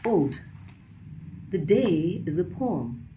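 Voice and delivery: lifted poetic reading with pauses between phrases; poetry recitation. Acted. Poet reading an ode on the day as a poem, lifted recitation. Reads second poem aloud.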